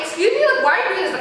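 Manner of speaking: high-pitched, incredulous challenge; indignant. Retold or imitated. Storyteller confronting the man blocking the cup, indignant. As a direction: challenge him in offended disbelief.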